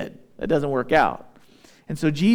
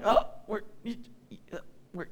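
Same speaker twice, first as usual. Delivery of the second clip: put-on breathy, voiceless little-kid wondering, fading away; puzzled toddler; 6 semitones higher, 9 dB quieter, faster than usual. Toddler watching dad leave, voiceless puzzled child voice. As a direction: whisper it like a lost toddler. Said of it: "he looks at me like".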